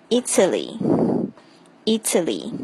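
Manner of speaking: Italy stressed on the first syllable I, slow. Shown as a demonstration. Teacher showing learners where Italy is stressed, slow. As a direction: lean on the I of Italy. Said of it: "注意这个重音是在I上面".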